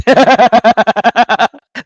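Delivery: long, loud rolling laugh. Real, not acted. Host reading a viewer's joke, laughing loudly. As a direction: burst out in loud repeated ha-has.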